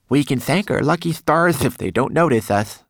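high-pitched, lisping little servant; lisping manikin. A narrator's reading. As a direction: warn anxiously in a small creature's lisp. Twigleg's lisping character voice.

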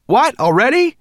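high, loud disbelief; startled. A narrator's reading. Ben surprised by the turn, startled cry. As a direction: blurt it out in surprise.

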